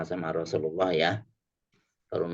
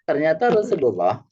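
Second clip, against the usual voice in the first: normal talking voice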